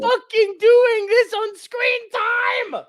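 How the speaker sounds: high, slow yell; loud, drawn-out protest; exasperated shout. Real, not acted. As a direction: bellow it at the rambling friend. Friend yelling at the story.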